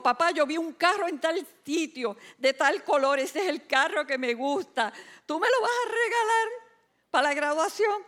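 high, eager request in imitation; imitated hopeful son. Son asking father for graduation car, high and eager imitation.

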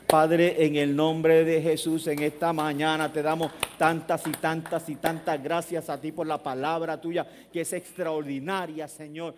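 slow, softening prayer voice. Acted. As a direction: pray gently to close the service. Leads closing prayer.

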